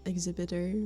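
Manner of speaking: normal talking voice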